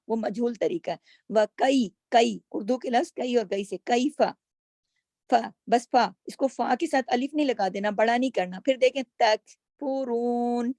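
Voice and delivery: ordinary delivery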